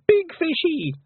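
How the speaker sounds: put-on very high little-boy voice; Wentworth. A narrator's reading. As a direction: squeal it like a toddler. Small brother repeats his cry.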